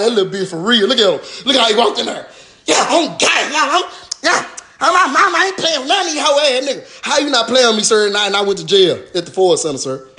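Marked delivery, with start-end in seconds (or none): 0.0-7.0 high, laughing imitation of a weak tough guy; lightly mocking attacker. Mocking the attacker's weak video, high and laughing. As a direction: clown a fake gangster's brag. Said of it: "I was like".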